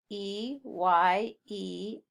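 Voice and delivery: very slow letter-by-letter spelling; measured classroom spelling. Shown as a demonstration. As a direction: give each letter its own beat. Spelling a word for learners.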